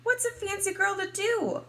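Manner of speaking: high-pitched theatrical question to a doll; little girl's plea. Acted. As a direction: ask it dramatically, way up high. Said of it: "I ask my doll Maribel".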